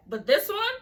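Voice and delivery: high and loud on this one; dramatic build-up. Real, not acted. Teasing viewers before the worst statement, high emphasis. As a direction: pitch it up to tease the big reveal.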